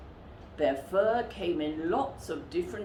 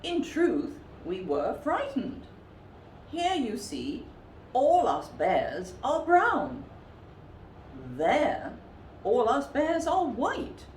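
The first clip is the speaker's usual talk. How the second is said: polar bear explaining; 7 semitones higher than usual. A narrator's reading.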